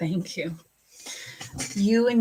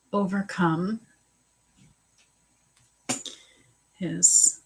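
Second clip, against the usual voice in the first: slow deliberate reading; slower than usual. Acted. Slowly rereading the viewer's question.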